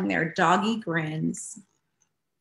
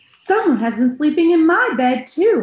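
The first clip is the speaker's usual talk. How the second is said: much higher, louder cry; crying out Mama Bear; 10 semitones higher, 9 dB louder than usual. A narrator's reading. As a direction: cry out in alarm like a mother bear. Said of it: "Mama Bear cried".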